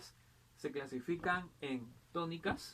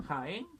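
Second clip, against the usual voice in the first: Jaén said ja-ÉN, rising; stress on last syllable. Shown as a demonstration. Teacher showing palabras agudas, Jaén stressed on ÉN. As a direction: lean on the end of Jaén. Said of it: "en la última sílaba".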